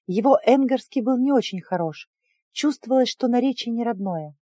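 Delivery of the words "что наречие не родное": ordinary delivery, nothing marked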